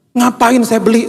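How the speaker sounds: high-pitched incredulous objection; indignant protest. Retold or imitated. Father balking at buying his child's painting, high indignant protest.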